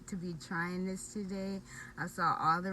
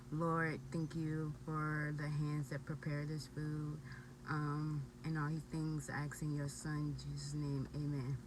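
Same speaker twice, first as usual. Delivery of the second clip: quiet prayer; 4 semitones lower, 5 dB quieter than usual. Real, not acted. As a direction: pray softly over the food. Blesses the food.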